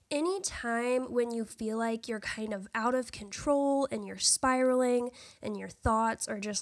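ordinary talk, nothing marked

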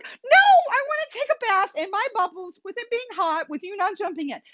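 high, coaxing voice pleading with a puppy; talking to the puppy. Retold or imitated. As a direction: shoo the dog out of the bath in a high baby-talk voice. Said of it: "And I'm like".

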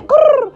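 high, loud mock growl; playful growl. Playful growl after Okay.

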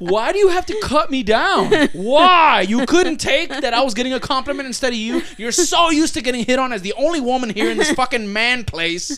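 hammed-up fury at the lady who cut her down; retold angry rant. Retold outburst at rude lady.

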